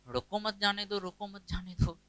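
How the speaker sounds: "रोको मत जाने दो" read straight through, softly, with no comma pause. Shown as a demonstration. Teacher mimics students reading only what they see, "रोको मत जाने दो" unbroken. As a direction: run "रोको मत जाने दो" together softly, as it looks on the page. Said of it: "आप लोग तो वही पढ़ रहे हैं जो दिख रहा है".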